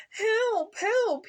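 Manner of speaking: high, loud cries for help from a hole; crying for help. A narrator's reading. Puppy stuck in a hole, crying for help, high and loud. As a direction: call up out of the hole, pleading. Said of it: "he cried".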